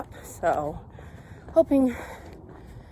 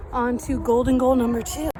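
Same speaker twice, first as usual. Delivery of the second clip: usual speaking voice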